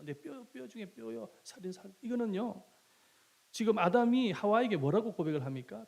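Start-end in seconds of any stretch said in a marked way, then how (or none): 0.0-1.9 tender confession. Shown as a demonstration. Adam softly tells Eve she is his own flesh, tender. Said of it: "내 분신이라는 거예요".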